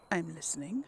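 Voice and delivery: whispered under the breath. Real, not acted. Streamer reading a dialogue option to herself, whispered. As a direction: whisper the choice to yourself, barely voiced.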